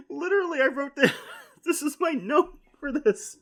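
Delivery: high-pitched laughing through words. Real, not acted. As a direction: laugh while you talk. Laughing at own note.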